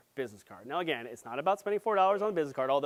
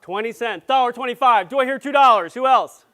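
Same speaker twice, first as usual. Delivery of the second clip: loud, higher auction-style call; calling out bids; 6 semitones higher, 11 dB louder than usual. Real, not acted. Presenter fielding price guesses from the audience, calling out. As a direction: call out the bids to the whole room.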